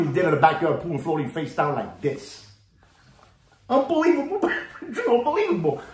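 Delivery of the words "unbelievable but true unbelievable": high refrain broken by a laugh; chuckling high unbelievable; laughing catchphrase. Real, not acted.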